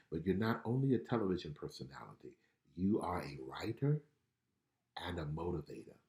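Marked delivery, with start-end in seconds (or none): 5.1-6.0 whispered. Real, not acted. Trailing soft encouragement.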